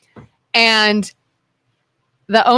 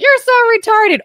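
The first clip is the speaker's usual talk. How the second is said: put-on high childish taunt; kid taunt; 14 semitones higher than usual. Brothers teasing each other as kids, high taunt. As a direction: sing it out like a bratty kid. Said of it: "they'd be like".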